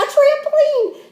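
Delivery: big high thrilled exclaim; excited. A narrator's reading. Teacher reading out first prize, high and thrilled. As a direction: sell the prize with a squeal.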